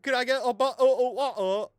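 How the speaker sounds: high, loud hammed-up British; overdone fake British accent; bad British accent. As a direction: stretch out the posh vowels. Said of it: "it's like".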